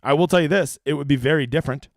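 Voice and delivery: usual speaking voice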